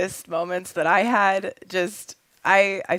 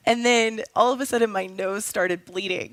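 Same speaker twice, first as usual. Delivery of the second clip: normal talking voice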